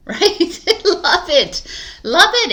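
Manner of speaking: laughing. Real, not acted. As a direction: giggle as you say it. Laughs at her own advice.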